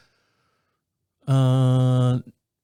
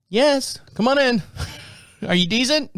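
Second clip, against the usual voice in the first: higher, singsong invitation; cheerful call through door; 8 semitones higher than usual. Retold or imitated. Answering knock at exam door.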